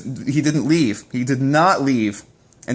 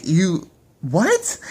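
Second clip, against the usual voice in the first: incredulous; 6 semitones higher than usual. Real, not acted. Disbelief at boyfriend's choice.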